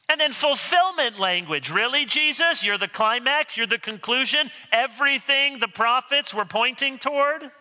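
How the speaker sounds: high, rising disbelief; incredulous questioning. Acted. Skeptic doubting Jesus is the climax of prophecy, incredulous.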